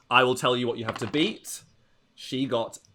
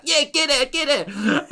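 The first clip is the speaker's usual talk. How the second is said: cheering sister; 5 dB louder than usual. Acted. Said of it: "your sister's like".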